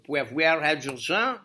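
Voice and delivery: hammed-up attempt at a French accent; bad French accent. Shown as a demonstration. Lecturer explaining where pH comes from, bad French accent. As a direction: put on a clumsy French accent. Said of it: "That was a pretty bad French accent".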